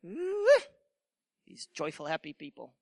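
disdainful "ooh", then low, hushed disapproval; looking down on them. Acted. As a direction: sneer softly at the happy people, dropping low. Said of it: "people look down on them like".

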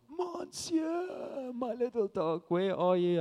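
high mock cry for a lost dog, falling away; Rocky-style anguished call. Teenager on a mountaintop calling his lost dog, Rocky-style anguished call. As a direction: imitate a drawn-out, heartbroken call across the farmlands. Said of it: "when he shouted for Adrian".

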